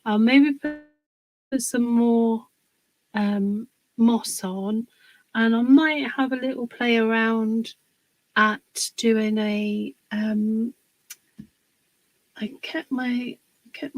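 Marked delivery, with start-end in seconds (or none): none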